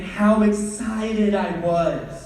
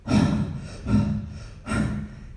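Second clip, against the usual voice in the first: heavy panting. Real, not acted. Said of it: "out of breath from acting out".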